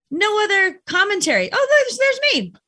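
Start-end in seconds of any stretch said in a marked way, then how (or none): 1.5-2.5 much higher, delighted little "oh, there's me"; pleased mirror greeting. Shown as a demonstration. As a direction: greet your reflection with gentle delight. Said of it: "or say".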